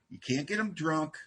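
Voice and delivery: ordinary talk, nothing marked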